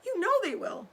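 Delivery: hint of sobbing mother fox; lightly sobbed plea. A narrator's reading. Mother fox to husband, mild mock sob. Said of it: "sobbed Missus Fox".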